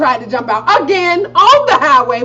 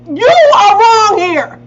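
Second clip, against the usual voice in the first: slow, loud, higher insistence; firm accusation; 9 semitones higher, 7 dB louder, slower than usual. Retold or imitated. Daughter telling mom she is wrong, slow and loud. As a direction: lay it down slowly and firmly. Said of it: "I'm not going to say I'm sorry".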